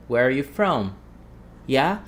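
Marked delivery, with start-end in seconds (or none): 0.1-1.0 "Where are you from?" run together so "are" nearly vanishes; "are" swallowed. Shown as a demonstration. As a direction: link "where" straight into "you", barely sounding "are". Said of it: "we don't pronounce the word".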